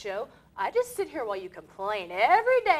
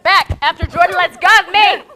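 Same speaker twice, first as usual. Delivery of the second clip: loud laughing protest; 4 semitones higher, 12 dB louder than usual. Real, not acted. Squeezed in a hug, protesting.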